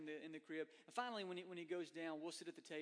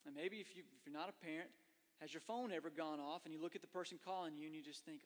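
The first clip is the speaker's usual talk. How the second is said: ordinary talk, nothing marked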